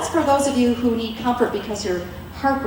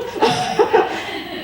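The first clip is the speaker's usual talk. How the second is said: high breathy laugh; 12 semitones higher than usual. Real, not acted.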